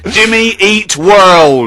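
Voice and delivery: slow, loud band-name call; announcer shout. Retold or imitated. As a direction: belt the name to the crowd.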